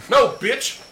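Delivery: the cousin swinging, dismissive retort. Retold or imitated. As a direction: shut him down flat out. Retelling blocking a swing.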